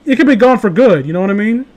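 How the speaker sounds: ordinary talk, nothing marked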